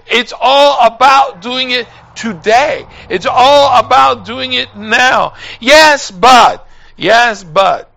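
put-on fiery preacher calling out to young people; preaching at full force. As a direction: drive the message home to the pews. Preaching, shouting.

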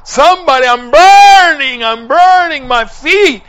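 high, agonized yell for help. Retold or imitated. Dying John screaming that his feet burn, desperate yelling. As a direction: yell at the top of your voice. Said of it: "starts yelling".

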